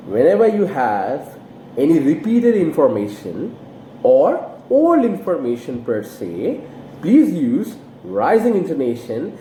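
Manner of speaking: ordinary talk, nothing marked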